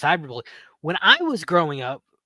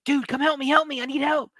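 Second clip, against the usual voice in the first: much higher, urgent cry for help; calling out in alarm for a passer-by; high, urgent plea to a stranger; panicked call for help; 10 semitones higher than usual. Retold or imitated.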